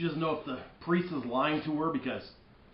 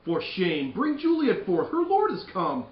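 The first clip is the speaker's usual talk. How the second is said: high, loud scolding; impatient father; 6 semitones higher, 6 dB louder, slower than usual. Acted. Lord Capulet demanding the bride, loud and impatient. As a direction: hurry them up, annoyed.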